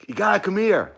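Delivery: loud high call across rooms. Real, not acted. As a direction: call him over eagerly. Calling roommate from another room.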